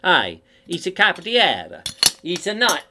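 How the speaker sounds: put-on big comic Italian accent. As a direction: switch to a hammed-up Italian. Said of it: "Or is it Italian?".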